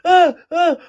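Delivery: high, loud startled yelps; scared yelps. Retold or imitated. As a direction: yelp like a kid jolted awake. Jumping out of bed scared.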